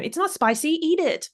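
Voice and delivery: mock parent telling a child to eat; insistent parent. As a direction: imitate a parent ordering a kid to eat.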